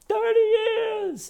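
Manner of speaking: mock shrieking complaint; mock outburst. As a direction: wail it in exaggerated outrage. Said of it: "Gail's just going".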